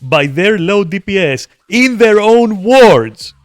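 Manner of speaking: furious rant. Real, not acted. Streamer yelling at a lying guildmate, furious. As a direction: blast them at full volume.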